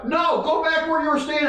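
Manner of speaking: high-pitched, exasperated refusal; exasperated cop order. Retold or imitated. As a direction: imitate an officer losing patience. Officer refuses and orders him back.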